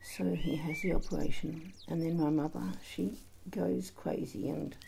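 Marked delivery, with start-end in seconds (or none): none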